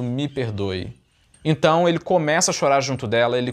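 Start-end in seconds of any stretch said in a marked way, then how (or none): 0.0-1.0 quiet tearful plea. Retold or imitated. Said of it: "ela chorando".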